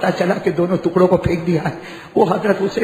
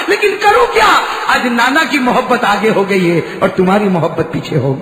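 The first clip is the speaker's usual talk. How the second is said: impassioned preaching; 9 semitones higher than usual. Acted. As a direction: plead for water for the child. Father's lament to dead sons.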